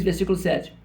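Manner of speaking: usual speaking voice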